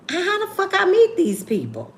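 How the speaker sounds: high disbelieving squeal; how the fuck, squealed high; incredulous. Real, not acted. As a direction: ask it in total disbelief. Incredulous question to audience.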